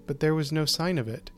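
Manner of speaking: ordinary delivery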